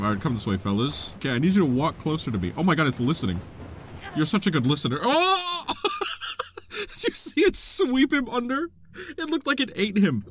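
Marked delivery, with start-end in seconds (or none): 5.0-10.2 high, laughing shock; cracking up in surprise; shocked laughter. Real, not acted.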